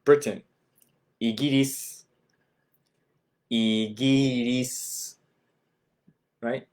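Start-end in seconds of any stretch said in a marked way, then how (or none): 1.2-5.2 Igiris with tapped r, final u dropped; イギリス; Japanese Igiris. Shown as a demonstration. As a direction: Igiris as i-gi-ris. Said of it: "The word for the United Kingdom".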